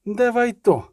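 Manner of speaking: high, curt demand; terse command. A narrator's reading. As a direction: order it briefly. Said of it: "сказала коротко".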